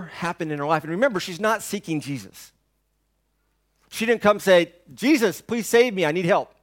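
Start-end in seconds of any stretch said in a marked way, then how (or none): 4.9-6.5 higher, louder mock plea for help; imagined plea. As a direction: play the desperate woman begging Jesus. Voicing her imagined plea.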